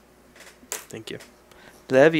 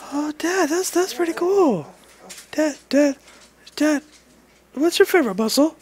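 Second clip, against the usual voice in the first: put-on high, childlike voice speaking as the girl; a dad voicing his daughter's question about his work, high and childlike; voicing his little daughter; 12 semitones higher than usual. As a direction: pretend to be the toddler asking Dad. Dad voices daughter asking him.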